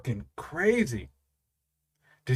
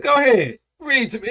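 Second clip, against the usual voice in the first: eager urging; 7 semitones higher, 6 dB louder than usual. Retold or imitated. Dad urging son.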